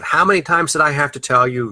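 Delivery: put-on the parent losing patience with the kid; exasperated parent. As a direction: snap it like a fed-up parent. Parent scolding child, contrasted with empathy.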